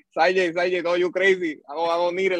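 retelling his scared protest to the sergeant; half-joking frightened plea; mock panic. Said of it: "yo empecé a decirle".

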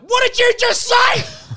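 high, slow, full-volume shriek; screamed challenge, every word stretched; enraged yell. Retold or imitated. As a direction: imitate an outraged guard exploding. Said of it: "she then yelled at".

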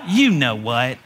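low, insinuating devil voice; sly, low imitation of the tempter; taunting devil. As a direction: drop deep for a mock devil taunt.

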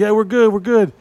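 high, loud forced-casual reassurance; playing it cool. Guys reassuring the stranger, high and over-casual. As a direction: act like nothing's wrong. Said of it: "We're just like".

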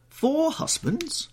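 very high, drawn-out incredulity; astonished. A narrator's reading. Hostess shocked at four husbands, astonished. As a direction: stretch the question out in high disbelief.

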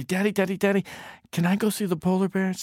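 put-on high, quick child's plea; high childish nagging; pleading little boy. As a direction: beg like an eager small son. Little boy begging his father.

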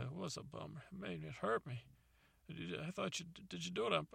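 high soft hurt father. Retold or imitated. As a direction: let the old man's hurt show. Father admits being hurt.

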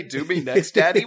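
high, loud repeat breaking into a laugh; incredulous quoting. Retold or imitated. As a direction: echo it in disbelief. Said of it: "Did that guy just say".

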